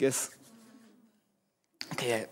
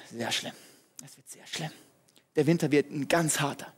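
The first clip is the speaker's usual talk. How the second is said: grim forecaster; 4 semitones lower, 4 dB quieter than usual. Acted. Weather service gives grim forecast.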